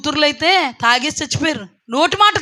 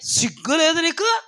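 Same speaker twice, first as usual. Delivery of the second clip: mock rebuke; 4 semitones higher than usual. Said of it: "అంటాడు దేవుడు".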